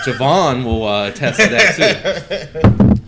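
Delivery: laughing through the words; teasing, giggling. Real, not acted.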